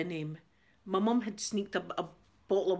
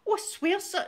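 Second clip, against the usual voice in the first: high, louder mock plea of innocence; pleading; 10 semitones higher, 4 dB louder than usual. Retelling pleading with the airport officer, high mock protest. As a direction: plead your innocence, pitched up.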